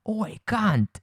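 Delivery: high-pitched mock Australian accent; badly faked Aussie, a bit British; Australian accent attempt. Shown as a demonstration. Co-host put on the spot, attempting an Australian accent. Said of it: "gonna do an Australian accent".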